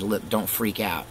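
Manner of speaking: ordinary delivery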